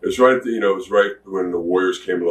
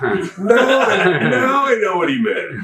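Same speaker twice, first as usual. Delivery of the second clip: high-pitched mock epiphany, drawn out at the end; sudden realization; 11 semitones higher, slower than usual. Wrestler riding the highway years later finally gets it, exclaimed realization. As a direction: let it dawn on you in a high, exclaiming voice.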